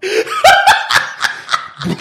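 high burst of laughter; loud laugh. Real, not acted. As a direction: crack up laughing. Laughs at his own bus joke.

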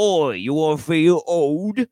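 loud, drawn-out Cockney; Cockney accent. Shown as a demonstration. Rewriting an actor's joke tweet for laughs, in a Cockney accent. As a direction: put on a big slow Cockney voice. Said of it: "in a Cockney accent".

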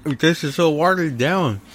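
talking while crunching ice; words around a mouthful of ice. Real, not acted. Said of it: "now I'm crunching on ice".

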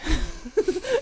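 high cracking laugh-sob; high laughing cry. Real, not acted.

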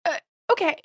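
high awkward okay. Retold or imitated. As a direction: squeak out an unsure okay. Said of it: "I was like".

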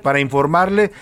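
high, energetic news-show opener; radio announcer opening. Acted. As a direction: launch the hour with lifted, projected announcer voice. (locutor de radio abriendo la hora con voz alta y enérgica)

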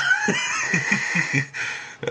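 a very high shrieking cackle; piercing high laughing squeal. Real, not acted.

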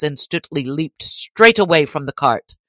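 plain reading voice

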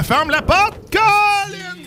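high call across the room. Real, not acted. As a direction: shout it to someone far off. Calling across the studio.

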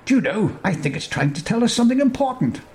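a hint of a pondering old sage; lightly voiced wizard Grandolph. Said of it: "says Grandolph".

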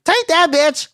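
high-pitched mock battle cry; imagined kicker's cry. Host imagining someone kicking the gunman, high-pitched comic taunt. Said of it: "I just imagine this dude be like".

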